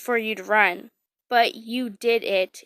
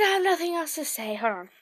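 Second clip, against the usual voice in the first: ordinary delivery, nothing marked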